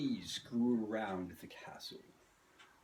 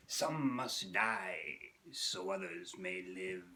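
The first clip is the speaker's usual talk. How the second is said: slow, lower ominous villain voice; Raven as Kevin Sullivan; 3 semitones lower, much slower than usual. Acted. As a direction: menace each word slowly. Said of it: "Raven impersonating Kevin Sullivan".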